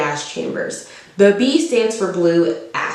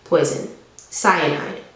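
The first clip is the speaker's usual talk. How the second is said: ordinary delivery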